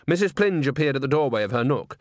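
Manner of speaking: usual speaking voice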